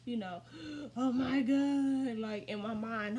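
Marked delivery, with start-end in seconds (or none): 1.0-2.2 loud, slow, higher-pitched wail; hammed-up tearful disbelief; mock crying. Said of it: "I was just crying".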